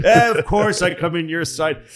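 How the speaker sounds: loud, high, hammed-up agreement; eager mock Stalin. Joseph agreeing to join FDR's side, eager mock Stalin. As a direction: agree over-eagerly in broken English.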